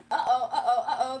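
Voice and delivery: loud, teasing uh-oh with a laugh; playful uh-oh. Real, not acted. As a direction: sing out a mock-alarmed uh-oh. Reacting to being told she has an accent.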